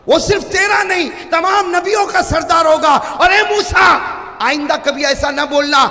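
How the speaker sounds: ringing, high oratorical delivery; impassioned preaching. Acted. Preacher voicing Allah's answer to Moses, high, forceful oration. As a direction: thunder out God's rebuke to Moses.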